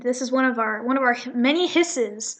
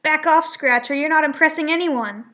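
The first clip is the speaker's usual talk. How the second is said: higher, louder defiant rebuke; defiant Audrey; 4 semitones higher, 6 dB louder than usual. Acted. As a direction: stand up to the bully. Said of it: "Audrey instead will be like".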